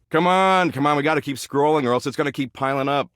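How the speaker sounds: high-pitched, hurried pleading; impatient urging. Acted. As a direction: urge them on, fast and high. Urging listener to keep scrolling.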